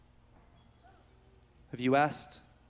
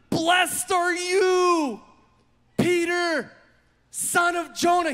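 high, loud, slow excited blessing; 8 semitones higher, 4 dB louder, slower than usual. Acted. Jesus praising Peter, loud and excited. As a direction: shout it with joy.

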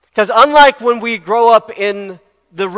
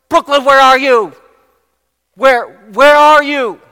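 higher, louder call across a store; calling out for child; 5 semitones higher, 6 dB louder than usual. Retold or imitated. Mother calling her hidden daughter in the clothes racks, raised call. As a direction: call the lost daughter's name, searching. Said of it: "So, so Christina's like".

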